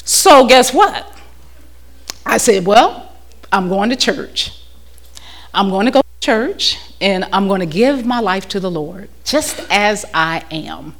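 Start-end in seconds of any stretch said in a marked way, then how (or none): 2.7-10.9 hushed retold vow. Retelling her own vow.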